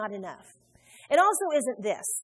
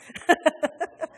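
laugh; 5 semitones higher, 4 dB louder than usual. Real, not acted. Laughs at own joke.

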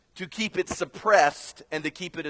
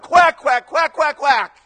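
very high, loud duck quacks; frenzied duck congregation; frenzied quacking; 10 semitones higher, 9 dB louder, slower than usual. Acted. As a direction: quack wildly, all stirred up. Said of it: "there would be this frenzied quacking".

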